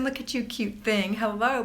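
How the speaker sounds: ordinary delivery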